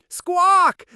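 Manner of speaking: loud high squawk; squawking bird. Acted. Startled bird squawking at the noise, loud and high. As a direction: squawk like a panicked parrot.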